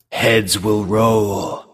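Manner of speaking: very slow ominous trailer read; movie trailer voice. Acted. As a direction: drag it out like a horror trailer. Reads tagline as movie trailer.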